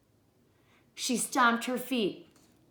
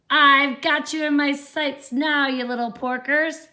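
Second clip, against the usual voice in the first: higher, loud gloating witch; cackling witch; 5 semitones higher, 8 dB louder than usual. A narrator's reading. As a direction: cackle it over your prey. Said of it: "she cackled".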